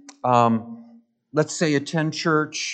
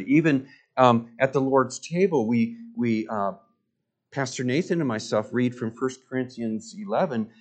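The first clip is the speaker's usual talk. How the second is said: usual speaking voice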